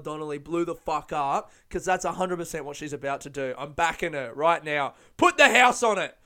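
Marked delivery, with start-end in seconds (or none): 5.2-6.2 loud, high burst of enthusiasm; excited hype. Real, not acted. Host backing the singer to blow up, excited shout. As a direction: shout it like a sure bet. Hyping the singer, urging a bet.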